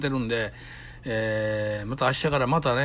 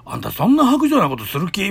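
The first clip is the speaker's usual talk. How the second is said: imitating girlfriend's reproach; 6 semitones higher, 7 dB louder than usual. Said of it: "みたいに、その時の女言うから".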